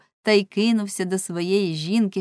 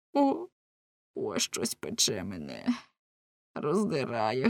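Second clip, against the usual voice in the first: low, slow, subdued moan; agonized groaning; 7 semitones lower, 4 dB quieter, slower than usual. A narrator's reading. Husband feeling something burn him, groaning in agony. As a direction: drag it out low, as if in pain.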